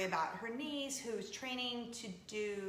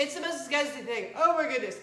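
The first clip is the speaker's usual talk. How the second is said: louder, theatrical disgust; louder melodramatic groan; dramatic disgust; 3 semitones higher, 9 dB louder than usual. Retold or imitated. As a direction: overplay the revulsion.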